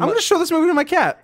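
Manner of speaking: quick, high excited declaration; excited fast. Real, not acted. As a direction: blurt it out, eager and fast. Excited joke.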